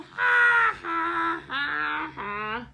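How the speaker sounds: spelled-out ha ha ha, starting high and loud and sinking; jeering team laughter that fades; mocking laughter. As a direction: laugh someone off the field. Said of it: "they laughed him off the field".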